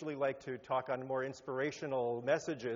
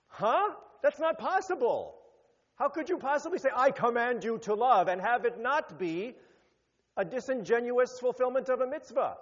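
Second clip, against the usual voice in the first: incredulous objection; 7 semitones higher, 5 dB louder than usual. Retold or imitated. Rabbis voicing an incredulous objection.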